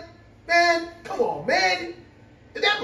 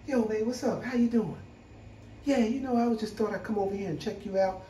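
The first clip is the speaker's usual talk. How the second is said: smooth pickup voice; 9 dB quieter than usual. Retold or imitated. Friend changes his voice to talk to a woman, smooth and soft. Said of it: "all of a sudden his voice changed".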